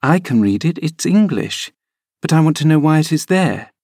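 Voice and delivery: put-on a bright young lady showing off; lightly voiced young girl. A narrator's reading.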